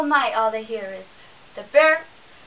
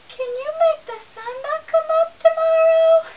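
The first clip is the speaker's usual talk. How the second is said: tiny squirrel; 10 dB louder than usual. A narrator's reading. Squirrel challenging the boastful bear, very high and small. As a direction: squeak up at the big bear.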